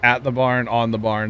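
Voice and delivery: usual speaking voice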